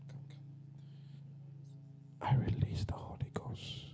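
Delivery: ordinary talk, nothing marked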